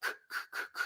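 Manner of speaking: "k" as soft voiceless clicks; quiet "k" pops from the middle of the tongue; isolated K sound. Shown as a demonstration. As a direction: say "k" with no vowel, each burst separate. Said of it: "we're gonna pronounce k".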